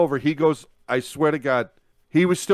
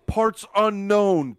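slow, higher ring announcer intro; 4 semitones higher, much slower than usual. Pretend. Fan playing ring announcer, slow and higher. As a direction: boom out a wrestler's billing. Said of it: "I was play".

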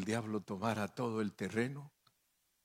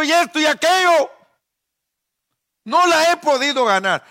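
screamed, much higher and louder; desperate shout; 16 dB louder than usual. Acted. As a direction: cry out to absent brothers for help. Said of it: "Todavía puedo gritar".

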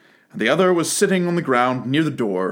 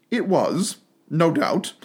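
usual speaking voice

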